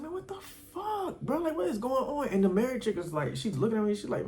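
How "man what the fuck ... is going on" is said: high, hushed disbelief; incredulous. Retold or imitated. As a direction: retell being baffled, squeaky and under the voice. Retold shock at her warning.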